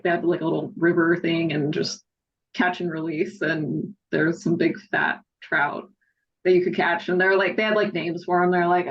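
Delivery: usual speaking voice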